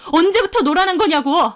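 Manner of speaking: high, exploding question; angry demand. Acted. Fiancée interrogating cheating boyfriend, angry. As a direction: burst out demanding an answer.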